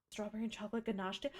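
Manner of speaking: usual speaking voice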